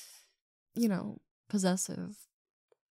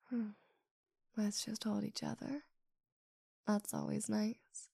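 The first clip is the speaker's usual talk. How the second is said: tender murmur; 6 dB quieter than usual. Acted. Settling down to hold a partner, tender murmur. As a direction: speak softly and let it relax.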